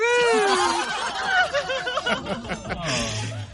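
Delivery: big hearty party laugh; loud group laughter. Acted. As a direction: roar with laughter.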